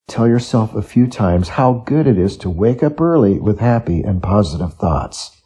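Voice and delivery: ordinary talk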